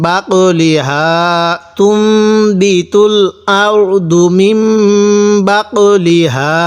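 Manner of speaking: বাকলিহা and তুমবিতুল stretched very slowly; slow tajweed demo; drawn-out recitation. Shown as a demonstration. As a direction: chant every syllable long like Quran class. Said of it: "এরকম করে পড়ব".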